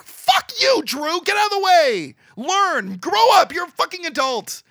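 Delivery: high loud mock rant at Drew; mock angry yell. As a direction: yell it like you are telling him off. Venting at character Drew.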